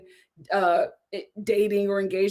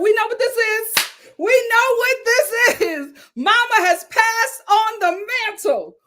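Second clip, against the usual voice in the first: dramatic exclamation; 10 semitones higher, 6 dB louder than usual. Real, not acted. Mock-dramatic reveal about mom.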